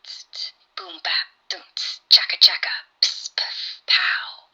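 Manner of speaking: breathy whispered drum sounds; real beatbox, all whispered. Acted. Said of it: "Keep going".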